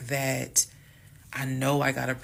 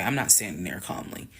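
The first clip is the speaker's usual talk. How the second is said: plain reading voice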